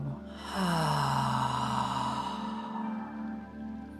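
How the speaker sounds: long, airy sigh out; audible sighing exhale. Shown as a demonstration. Guide showing listeners how to exhale, long audible sigh. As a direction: let all the breath go in one drawn-out sigh. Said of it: "Loudest exhale".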